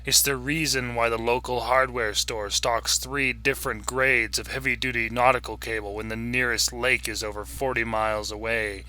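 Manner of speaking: ordinary delivery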